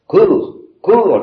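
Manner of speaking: high, loud shout of cours; shouted command. Acted. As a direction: yell it at the boy to run. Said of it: "lui crient les piqueurs". (crier cours fort et aigu)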